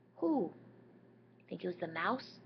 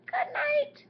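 very high, louder squeaky mouse; 6 dB louder than usual. Acted. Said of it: "The mouse says".

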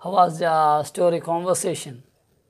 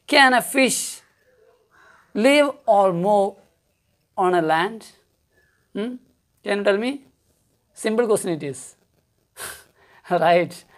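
normal talking voice

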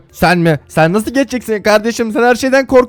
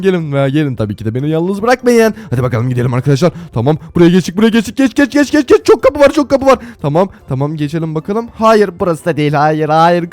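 normal talking voice

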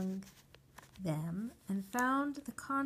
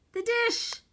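much higher, loud desperate cry; despairing call; 14 semitones higher, 10 dB louder than usual. A narrator's reading. The boy desperately calling out for his lost dish. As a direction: call out like a child losing hope. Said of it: "I called out despairingly".

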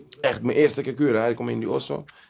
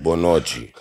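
imitating heavy voice; 7 semitones lower, slower than usual. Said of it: "Hij zegt".

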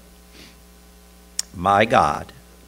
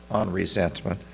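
ordinary delivery

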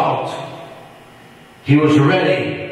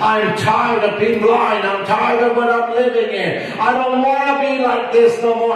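desperate beggar; 7 semitones higher than usual. Acted. Blind Bartimaeus begs Jesus.

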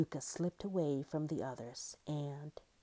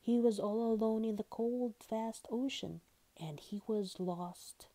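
ordinary delivery

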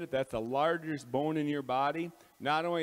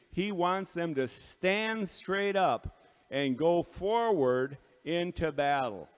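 fervent preaching; 4 semitones higher, 5 dB louder than usual. Real, not acted. Preaching builds on stand.

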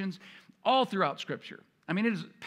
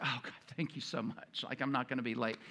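soft, breathless thank-you to God; hushed relieved prayer; 6 semitones lower, 8 dB quieter than usual. Retold or imitated. Believer quietly thanking God for found keys, hushed. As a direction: murmur it like a sigh of relief.

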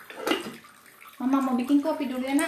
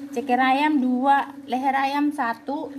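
menu announcement; 5 dB louder, much slower than usual. Acted.

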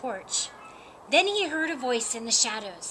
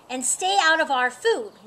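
loud, higher storybook warning; raised-voice cat laying down the rule; 4 semitones higher, 7 dB louder than usual. A narrator's reading. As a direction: warn them off firmly. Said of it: "warned the tabby cat".

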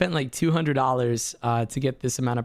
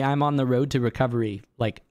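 ordinary talk

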